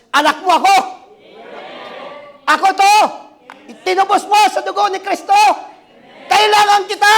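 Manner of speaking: high, loud desperate shout; crying out to God. Retold or imitated. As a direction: shout to God at full force. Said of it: "sumigaw sa Diyos". (buong lakas na sigaw sa Diyos)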